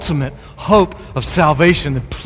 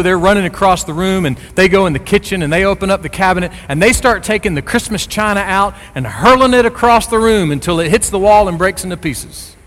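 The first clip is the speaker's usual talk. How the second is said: ordinary talk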